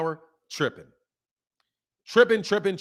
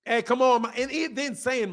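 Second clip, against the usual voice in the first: usual speaking voice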